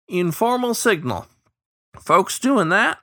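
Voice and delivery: high, drawn-out riverman twang; folksy old bird voice. A narrator's reading. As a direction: explain like an old hand. Early explains.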